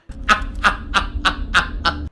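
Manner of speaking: another unvoiced wheezy laugh; breathy laugh demo. Shown as a demonstration. Second demo for chat of his laugh, wheezing.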